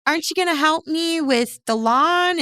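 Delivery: high nagging mom voice. Retold or imitated. Mocking his mom asking about the lawn, high and nagging. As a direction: whine it like a pushy mom.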